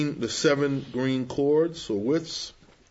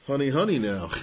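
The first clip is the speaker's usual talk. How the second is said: lightly voiced Delilah. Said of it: "Delilah says to him".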